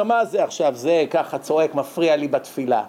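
usual speaking voice

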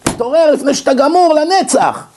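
high, urgent wake-up call; shock-treatment rebuke. Acted. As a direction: jolt the listener with a high-pitched exhortation. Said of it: "לזעזע אותו".

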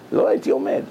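לוחש את הווידוי בשקט; כמו סוד שקשה להודות בו; לחישה. Retold or imitated. הרב מתוודה על חולשתו. (וידוי בלחישה)

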